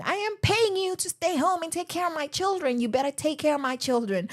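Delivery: mock a TV chauvinist ordering his wife around, in an odd accent; chauvinist husband accent. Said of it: "I don't know where that accent came from".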